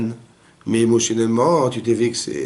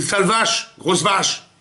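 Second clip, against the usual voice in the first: higher-pitched, drawn-up taunt; mock insult; 7 semitones higher, much slower than usual. Man in a field insulting his cow, mock abuse. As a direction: hurl it at a grazing cow. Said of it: "vous rentrez dans le pré, vous dites".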